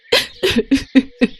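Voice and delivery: short tearful sobs; acted sobbing. Widow sobbing after her grief. (सिसकियाँ)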